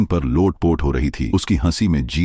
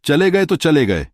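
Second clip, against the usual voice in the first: high-pitched, gleeful giggly voice; laughing it off; 12 semitones higher than usual. A narrator's reading. Streamer celebrating her hundred crore, giggly and high. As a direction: brush it off in a high, delighted squeal. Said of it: "लेकिन फिर हंसते हुए रुक गई".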